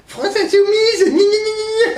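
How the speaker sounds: very high whiny mockery; mocking whiny voice. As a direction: sneer it in a squeaky voice. Mocking France Insoumise supporters.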